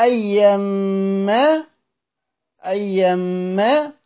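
أيما very slow, with a long held m; drawn-out recitation. Shown as a demonstration. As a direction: hold the m in أيما, then open into aa. Teacher demonstrates recitation of word. (أيما بتطويل الغنة في الميم)

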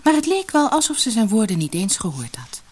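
ordinary delivery

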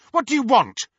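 high, loud hostile demand; angry shout. Acted. Snape shouts at Petunia who was spying, loud and high. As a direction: snap it at her, loud and high. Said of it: "he shouted".

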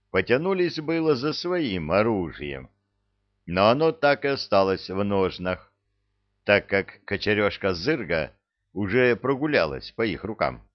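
ordinary delivery, nothing marked

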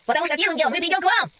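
mild narrated shout; shouting back. A narrator's reading. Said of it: "прокричал Финн".

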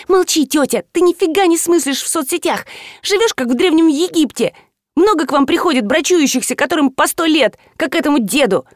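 high, bossy schoolgirl; напористая племянница. A narrator's reading. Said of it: "затоптана активной племянницей". (высоким голосом отчитывая тётю)

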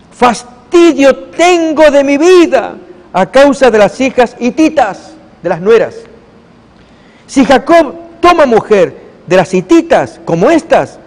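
exasperated mother-in-law complaining to her husband; weary wife. Acted. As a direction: wail it like a wife sick of her life. Rebeca laments to husband.

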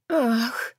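high sudden gasp "Ах"; breathy gasp of pleasure. Acted. Heroine gasps under caresses. (тихий вздох наслаждения)

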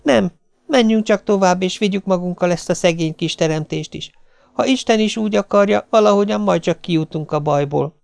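high, pleading then resolute; refuse, then gather courage; resolute young mother. Acted. Jane telling the cook they go on with the baby, resolute.

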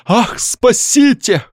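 high, drawn-out theatrical cry; crying out for help. A narrator's reading. Young man rushes at the doctor begging help, crying out. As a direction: plead for rescue, slow and high-pitched. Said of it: "бросился ему навстречу с криком".

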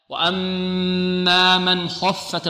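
slow من خفت with a heavy kha; careful recitation; recitation demo. Shown as a demonstration. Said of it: "الخاء مفخمة".

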